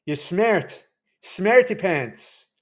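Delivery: playful mock Irish, drawn out; Irish accent. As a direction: tease in a slow Irish accent. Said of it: "That's how they say it in Irish".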